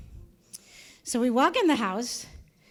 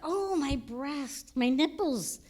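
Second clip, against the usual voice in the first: ordinary talk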